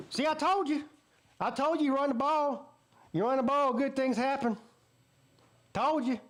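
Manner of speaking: high-pitched crowing I-told-you-so voice; Dabo. Acted. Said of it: "Dabo's over there going".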